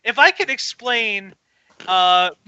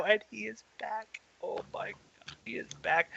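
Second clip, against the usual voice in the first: hushed awe; 17 dB quieter than usual. Real, not acted. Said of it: "awed whisper".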